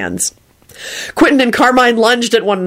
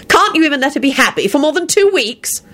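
high-pitched indignant accusation; demanding; 8 semitones higher than usual. A narrator's reading. Sister-in-law scolding husband about his wife, demanding. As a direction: accuse him, pitch raised. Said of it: "she demanded".

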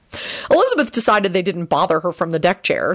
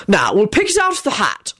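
mildly stern, raised pitch; lightly acted firm ruling; 4 semitones higher than usual. A narrator's reading. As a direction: lay down the rule to squabblers. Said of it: "Arthur responded sternly".

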